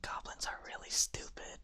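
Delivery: hushed whisper, no voice; in-character whisper. Acted. Yuka commenting on goblins while hiding from them, whispered. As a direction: whisper it as a sneaking aside. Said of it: "Yuka's like".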